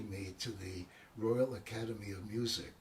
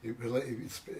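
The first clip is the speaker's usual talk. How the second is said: normal talking voice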